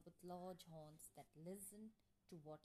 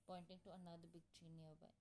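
ordinary delivery

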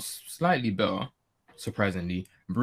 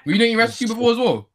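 incredulous, high and fast; 14 semitones higher, 6 dB louder, faster than usual. Real, not acted. Surprised question at friend.

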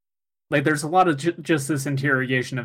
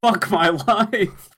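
laughing groan; 7 semitones higher, 5 dB louder, slower than usual. Real, not acted. Groaning about their age, laughing.

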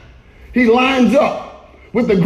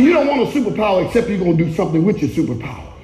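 normal talking voice

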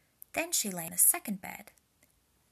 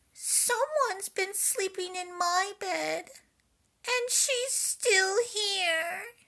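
much higher, louder, very slow little bear; a startled cub crying out; exclaiming Baby Bear; 12 semitones higher, 7 dB louder, slower than usual. A narrator's reading. Baby Bear discovering Goldilocks, high loud slow exclamation. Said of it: "exclaimed Baby Bear".

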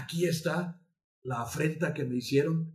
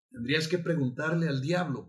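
usual speaking voice

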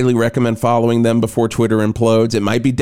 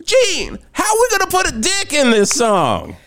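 high-pitched rock frontman shriek. Acted. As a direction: call out in a cartoonishly high singer's voice. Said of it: "[name]'s like".

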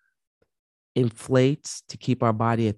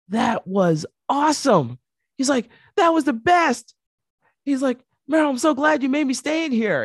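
much higher, louder, gushing with delight; excited Spielberg; 13 semitones higher, 5 dB louder than usual. Retold or imitated. Spielberg gushing to the driver after the landing, high and excited. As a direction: rave like a thrilled kid. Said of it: "And Steven Spielberg goes".

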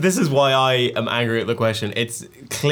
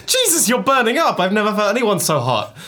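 high, shocked parent voice; the parent shocked by the fevered child; alarmed parent; 9 semitones higher than usual. Retold or imitated. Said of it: "It was always".

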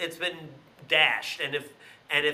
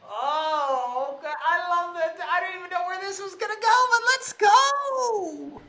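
excited high-pitched reaction; 14 semitones higher than usual. Real, not acted. Thrilled host reacting to the guest's woo-woo idea, excited. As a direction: voice jumps way up with delight.

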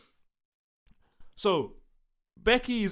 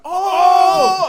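loud, high hooting "ohhh!"; a playground crowd jeering a burn; hammed-up shouted "oh!"; mock crowd "oh!"; 13 semitones higher, 16 dB louder than usual. Imitating crowd reacting to insult.

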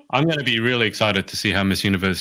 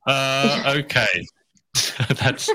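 ordinary delivery

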